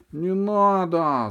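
put-on slow, high, loud child's yell. A narrator's reading. Boy in the chair yells at the dentist. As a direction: drag out a desperate no. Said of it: "заорал я".

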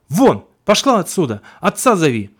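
loud, high, sharp shout to get out; barking old woman. Acted. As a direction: bark her out of the room. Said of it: "бабка как гаркнет на меня". (гаркнуть громко, как злая старуха)

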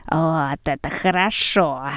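slow, gloating hawkish glee; drawn-out greedy delight; gloating old woman. A narrator's reading. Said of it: "с таким видом, в котором заметна была радость ястреба".

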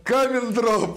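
high, loud, very slow laughing call; excited announcer shout. Acted. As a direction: belt it out like a prize announcement. Hosts announce winner.

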